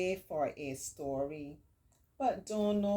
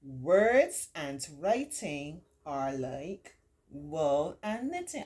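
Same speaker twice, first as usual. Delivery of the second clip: put-on slow, clear read-aloud voice for children; reading a picture book to kids; story reading; 5 dB louder, much slower than usual.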